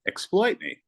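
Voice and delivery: a hint of an over-the-top quote; quoting Magic. Celebrity telling the young agent, mock high voice. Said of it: "magical words from a magical guy".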